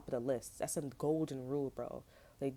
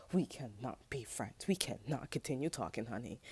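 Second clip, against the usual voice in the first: lower sassy dismissal; 5 semitones lower, 3 dB quieter than usual. Retold or imitated. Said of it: "I was like".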